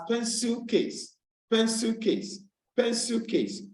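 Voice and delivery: "pencil case" with PEN high, case dropping; stress on PENCIL. Shown as a demonstration. Teacher showing where compound stress falls, stress on PEN in "pencil case". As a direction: lift the first syllable of "pencil case". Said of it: "the first word".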